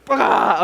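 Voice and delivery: imitated burst of pain, "aah!"; mock pain yell. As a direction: yell out a brief pretend "aah". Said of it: "but by the end".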